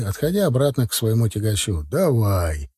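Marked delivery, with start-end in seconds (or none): none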